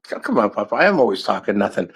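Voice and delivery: ordinary delivery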